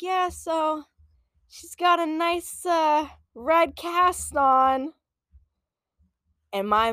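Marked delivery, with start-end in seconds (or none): 0.0-4.9 higher, slow and drawn-out; mock-careful dad voice; dad breaking news. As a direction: stall while delivering bad news, imitating dad.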